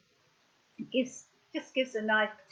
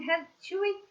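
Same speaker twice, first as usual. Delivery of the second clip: puppet voice; 10 semitones higher than usual. Pretend. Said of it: "they're saying".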